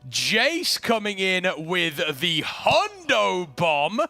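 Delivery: slow hyped-up announcer read; hoarse sleep deprived hype. Acted. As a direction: call out the subs like an announcer. Announcing hundred bomb.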